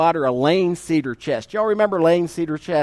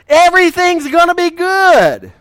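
much higher, louder naive cheer; starry-eyed optimism; 10 semitones higher, 6 dB louder than usual. Retold or imitated. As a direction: sound like a dreamy young groom. Young lover's rosy outlook.

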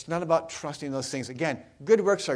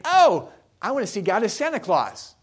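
higher, louder acted "Oh" of discovery; mock eager realization; 5 semitones higher, 6 dB louder than usual. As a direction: play someone seizing on a happy idea. Acted thought of an eager believer.

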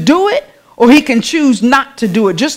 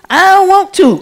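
high-pitched mock child's refusal; defiant child; 8 semitones higher than usual. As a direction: whine back like a stubborn kid. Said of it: "when a child doesn't wanna do something".

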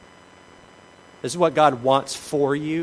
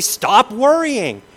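loud, high, drawn-out "Stop worrying"; mock telling off a worrier; 10 semitones higher, 11 dB louder, much slower than usual. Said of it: "walk up to an anxious or worried person and say".

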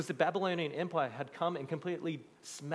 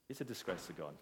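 even quieter emphasis; 6 semitones lower, 9 dB quieter than usual. Shown as a demonstration. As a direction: lower and softer still, "disgrace" sinking.

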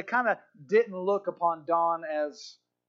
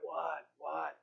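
very soft, whispered question; hushed reply; 2 semitones lower, 11 dB quieter than usual. Retold or imitated. Groggy doctor answering a fellow prisoner, hushed. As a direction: answer under your breath.

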